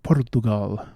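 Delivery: Portugal in a mock Portuguese accent, each syllable sounded out; put-on Portuguese accent. Shown as a demonstration. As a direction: say Portugal as Por-tu-GAL, imitating a Portuguese accent. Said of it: "I shouldn't say it in that accent".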